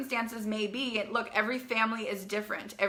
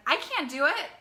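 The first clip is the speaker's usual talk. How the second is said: higher, pleading "I can't do it"; higher-pitched imitation of a discouraged student; whiny quote; 6 semitones higher than usual. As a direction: whine it like an overwhelmed girl. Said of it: "girls and young women who say things like".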